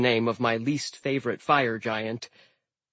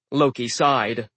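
ordinary delivery

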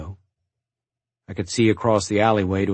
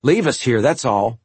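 mild snarl, pitched up and pushed; lightly snarled retort; 5 semitones higher, 4 dB louder than usual. A narrator's reading. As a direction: snap back with a touch of defiance. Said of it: "Walter snarled".

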